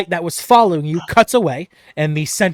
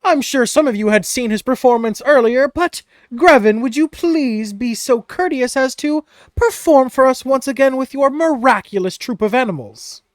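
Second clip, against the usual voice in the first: high, showy ringmaster voice; Mister Light asking Grevin to perform for the crowd, with flourish; 10 semitones higher, 6 dB louder than usual. Acted. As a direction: invite the star performer with grand courtesy. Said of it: "he says".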